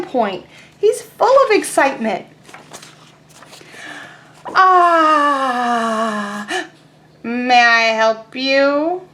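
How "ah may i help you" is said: slow, put-on pigeon voice; drawn-out polite pigeon; pigeon asking. Teacher voicing Pigeon asking the duckling, slow and put-on. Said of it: "Pigeon asked".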